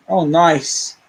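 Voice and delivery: usual speaking voice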